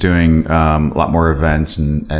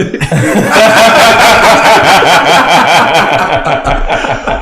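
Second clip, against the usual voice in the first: much higher, louder burst of laughter; 10 semitones higher, 6 dB louder than usual. Real, not acted. Cracks up at crude joke.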